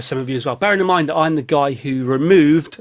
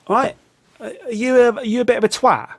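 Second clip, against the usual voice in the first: imitated passer-by; 6 semitones higher than usual. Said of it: "they come out of their way to walk over to me to say".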